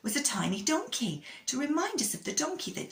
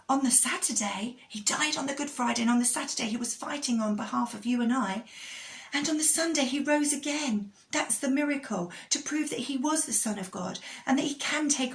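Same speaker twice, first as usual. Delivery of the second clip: put-on upbeat, higher children's presenter; kids show host; 5 semitones higher than usual. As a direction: cheer the children on.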